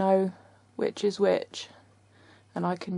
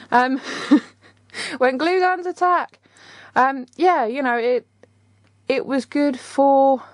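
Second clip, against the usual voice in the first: laughing; 8 semitones higher than usual. Real, not acted. Laughing after glue gun mishap.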